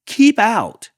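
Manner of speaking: very high, loud shout; grumpy yell. Acted. Cactus yelling at the tortoise to go. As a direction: bark it at the intruder. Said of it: "yelled Hank".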